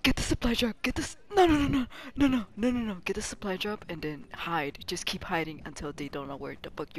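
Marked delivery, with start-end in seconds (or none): 1.3-2.9 loud, high panicked no; frantic loud no; panicked noes. Real, not acted. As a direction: cry out in alarm. Panicking as enemy appears.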